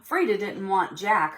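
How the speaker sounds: usual speaking voice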